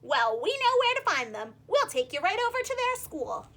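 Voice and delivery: cheerful fish voice; helpful striped fish. Acted. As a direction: offer help gladly.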